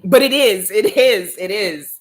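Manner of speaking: loud, high insistence fading back down; contradicting her viewers, emphatic then tapering; insistent. Real, not acted. Insisting against viewers' denial.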